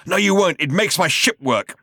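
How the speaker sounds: high, loud and indignant; loud refusal. Acted. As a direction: shout it back at the robot. Zaphod's louder refusal.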